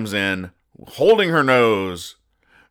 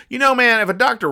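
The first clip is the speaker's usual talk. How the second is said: exclaiming; 6 dB louder, faster than usual. Real, not acted. Sudden outburst.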